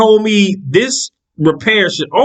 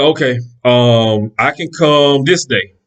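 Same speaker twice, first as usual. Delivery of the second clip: slow mechanic; 6 semitones lower, slower than usual. Retold or imitated. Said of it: "He said".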